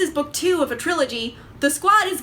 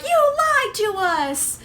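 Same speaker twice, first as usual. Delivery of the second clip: high, slow, drawn-out mock accusation; accusing outburst; 9 semitones higher, slower than usual. Squad members yelling at their crewmate, high mock outrage. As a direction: wail it like a betrayed teammate. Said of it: "And they're like".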